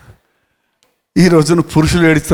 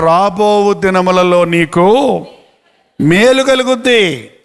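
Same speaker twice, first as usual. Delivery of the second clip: slow promise; much slower than usual. Acted. Slow verse recitation.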